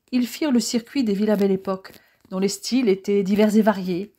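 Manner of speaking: ordinary delivery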